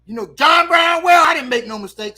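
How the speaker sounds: loud, high mock-shouted denial; hammed-up indignant teacher. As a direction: fire back at a pupil, high and loud. Teacher angrily denies the mistake.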